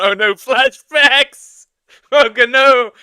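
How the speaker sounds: loud, high, drawn-out wail; mock panic. German streamer having joke flashbacks at the accent, mock panic. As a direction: cry out high and slow in pretend horror.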